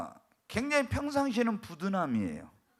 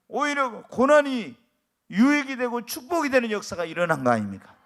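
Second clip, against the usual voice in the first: emphatic preaching; 3 semitones higher, 7 dB louder than usual. Acted.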